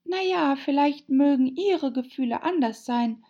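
put-on high-pitched girl character; Alice, earnest and high; polite Alice. A narrator's reading.

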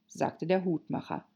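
ordinary delivery

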